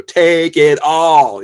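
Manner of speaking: loud, slow, comically exaggerated diction; over-enunciating. Shown as a demonstration. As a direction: hit each word hard, drawn out and overdone. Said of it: "really over enunciating all the words".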